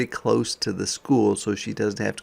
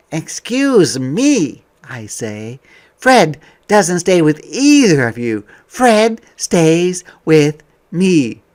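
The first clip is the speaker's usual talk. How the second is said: higher, louder, slow and firm; child insisting the dog stays with her; determined child; 7 semitones higher, 11 dB louder than usual. Acted. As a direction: stand your ground politely. Child stands up to parents.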